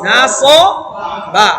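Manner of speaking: very slow, each syllable drawn out; broken into syllables. Shown as a demonstration. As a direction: spell it out slowly for learners. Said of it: "এবার এটা কি হবে?".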